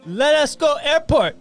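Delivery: imitating mom's bad Mexican accent, very high and loud; drawn-out, high-pitched fake Mexican accent. Scared mom pleading with a cop, bad Mexican accent. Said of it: "starts speaking to him like this".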